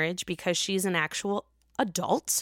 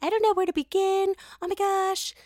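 much higher, breathless mock-dramatic gush; mocking other podcasters; 9 semitones higher than usual. Mocking rival podcasters' shocked opener, higher mock gush. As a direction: parody their overwhelmed gasp. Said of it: "other podcasts that are basically saying".

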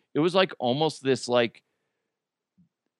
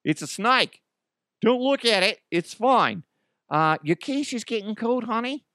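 higher-pitched casual Aussie brushing off danger; nonchalant Australian; 9 semitones higher than usual. Acted. Aussie calmly telling guests not to mind the snake, nonchalant. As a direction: put on a breezy, higher Australian voice. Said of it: "He's like".

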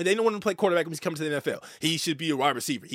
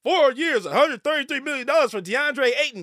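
louder, higher scoffing hater voice; mocking the haters; 7 semitones higher, 4 dB louder than usual. Host mocking critics of Ayton's contract, scoffing loud. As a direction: scoff at the price like an outraged critic. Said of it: "before you say it".

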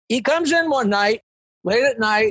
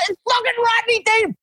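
excited shout; 13 semitones higher, faster than usual. Retold or imitated. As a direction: yell it across the room, half in shock. Shouting news to girlfriend.

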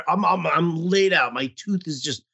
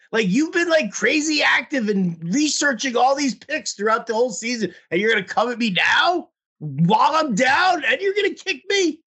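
rising, much higher mock-outraged shout; retold rant; 7 semitones higher than usual. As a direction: build the retold complaint into a high indignant yell.

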